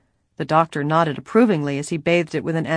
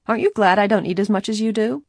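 teasing; 5 semitones higher, faster than usual. A narrator's reading. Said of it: "teasingly asked Bess".

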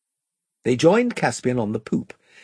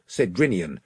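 ordinary delivery, nothing marked

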